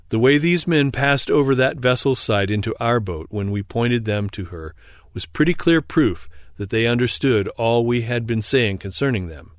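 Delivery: usual speaking voice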